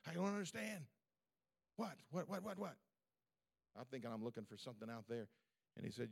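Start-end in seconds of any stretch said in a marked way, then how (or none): 0.1-2.8 high-pitched, bewildered protest; squeaky-high, hammed-up confusion; baffled exasperation. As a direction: act out being totally lost and frustrated. Retells baffled reply to God.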